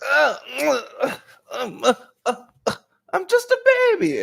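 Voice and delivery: put-on very high squeaky baby voice; whiny baby. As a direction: whimper like a fussing toddler. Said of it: "the collective is like".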